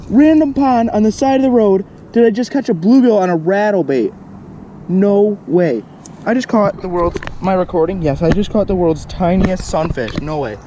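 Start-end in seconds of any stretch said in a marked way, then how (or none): 0.1-8.0 high, loud and incredulous; amazed disbelief. Real, not acted. Marvelling at a bluegill from a random pond, amazed. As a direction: exclaim it, astonished at your luck.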